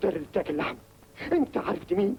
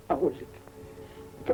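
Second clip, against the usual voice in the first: hesitant whisper; 4 semitones lower, 4 dB quieter, much slower than usual. Acted. Hesitant confession.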